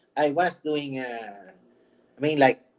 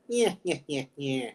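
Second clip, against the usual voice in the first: Penguin; 5 dB quieter than usual. Acted. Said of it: "I could be the Penguin".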